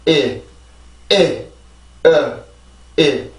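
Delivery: "이" stressed and longer, "으" weaker, each on its own; vowel rhythm drill. Shown as a demonstration. Teacher pulling out the vowels of the word, rhythm drill. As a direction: beat out the word's rhythm on bare vowels "이" and "으". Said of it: "이게 이 단어의 리듬입니다".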